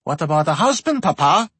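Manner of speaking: teasing, rising question; playful interjection. A narrator's reading. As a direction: cut in cheekily. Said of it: "interjected Anastasia".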